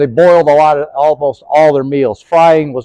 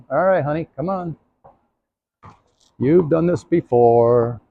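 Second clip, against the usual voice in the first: soothing a horse; 8 dB quieter than usual. Real, not acted. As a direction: gently reassure the mare. Soothing the horse mid-demo.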